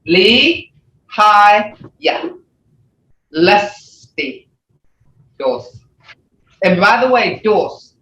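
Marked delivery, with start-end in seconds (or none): 0.1-4.5 "Lihaya Lustidos" slowly, with long gaps between syllables. Shown as a demonstration. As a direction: teach "Lihaya Lustidos" one slow syllable at a time. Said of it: "that's three syllable sound".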